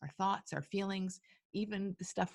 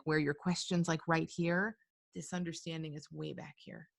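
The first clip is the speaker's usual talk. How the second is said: usual speaking voice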